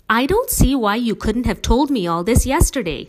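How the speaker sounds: loud exasperated complaint; complaining. A narrator's reading. Father at end of his rope complaining to family, loud and exasperated. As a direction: whine it at the end of your rope. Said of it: "he complained".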